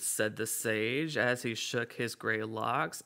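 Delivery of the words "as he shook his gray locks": plain reading voice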